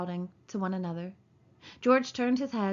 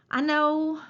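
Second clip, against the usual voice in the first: miserable; 7 semitones higher, 6 dB louder than usual. A narrator's reading. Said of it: "said Lenny miserably".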